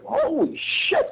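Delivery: mock shocked holy shit; stunned, drawn out. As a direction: let it hang like a scared rookie. Rookie shocked by accusation.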